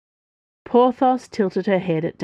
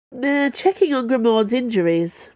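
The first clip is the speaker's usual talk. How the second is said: yawning; 6 semitones higher, 3 dB louder than usual. A narrator's reading. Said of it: "Porthos yawned".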